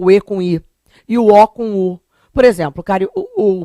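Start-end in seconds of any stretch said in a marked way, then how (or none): none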